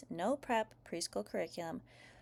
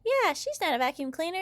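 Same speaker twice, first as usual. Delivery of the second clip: put-on high, louder kid protest; child's yell; 9 semitones higher, 5 dB louder than usual. A narrator's reading. Sister backs up grabbed child, high yelling protest. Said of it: "yells Bingo".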